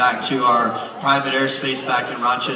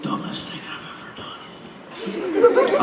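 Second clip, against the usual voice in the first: soft unvoiced whisper; whispered regret; whispered aside; 6 dB quieter than usual. Real, not acted. Muttered aside under breath.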